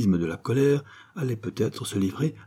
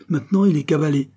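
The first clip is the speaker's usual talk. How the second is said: higher, louder gloating; the kid gloating to the bandits, higher and louder; triumphant; 7 semitones higher, 5 dB louder, faster than usual. Acted. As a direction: crow the victory. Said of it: "annonçait triomphalement".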